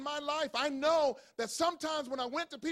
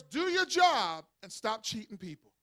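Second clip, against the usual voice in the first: normal talking voice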